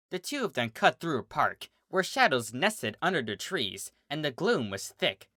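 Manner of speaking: ordinary delivery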